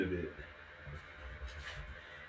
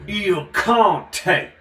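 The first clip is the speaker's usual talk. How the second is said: loud, higher, proud announcing of Il Conte; booming put-on voice; 8 semitones higher, 12 dB louder than usual. Reading the wine label aloud, booming put-on voice. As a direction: proclaim the wine name like a grand count. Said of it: "Somebody talks like that".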